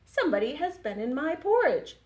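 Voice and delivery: a touch of big loud bear voice; Papa Bear. Acted.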